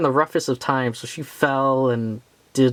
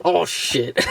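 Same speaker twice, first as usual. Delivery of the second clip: startled curse; 4 semitones higher than usual. Real, not acted. Figure slips while filming.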